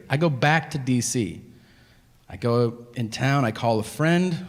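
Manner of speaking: usual speaking voice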